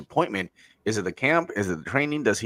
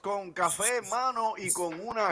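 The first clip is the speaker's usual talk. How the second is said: high, booming bilingual ring announcer hype; lucha libre announcer; 12 semitones higher, 9 dB louder than usual. Acted. Podcast intro hype announcer.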